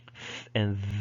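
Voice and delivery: "f" as voiceless th, "v" as voiced th; demonstrating th sounds. Shown as a demonstration. Teacher showing thorn and eth to students, demonstrating. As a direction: hold both th sounds, hiss then buzz. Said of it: "this is the thorn and this is the ed".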